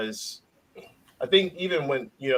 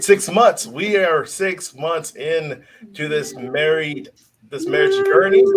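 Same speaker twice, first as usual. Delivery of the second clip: excited; 4 semitones higher, 7 dB louder than usual. Real, not acted. Excited newlywed boasting.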